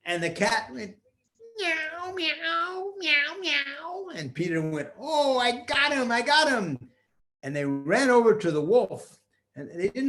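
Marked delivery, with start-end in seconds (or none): 1.6-4.1 high rising meows; cat meowing. Acted. Cat voiced in story. 5.0-6.8 excited. Acted. Peter's triumphant cry.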